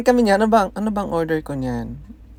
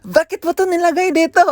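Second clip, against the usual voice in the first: mock yelling boss; 13 semitones higher, 3 dB louder than usual. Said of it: "sumisigaw nga".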